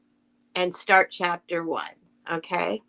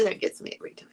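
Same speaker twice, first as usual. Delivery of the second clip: whispered to herself, much softer; 8 dB quieter, much faster than usual. Real, not acted. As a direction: breathe the words out with no voice. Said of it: "repeats the line, whispered".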